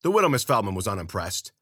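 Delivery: usual speaking voice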